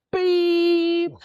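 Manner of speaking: long high held beep; high-pitched drawn-out beep; imitated beep. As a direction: beep like an electronic gadget. Imitates the device's tone.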